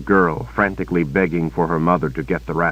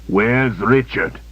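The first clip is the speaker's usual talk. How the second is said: cold interrogation; 4 semitones higher, slower than usual. Acted. Villain interrogates slowly.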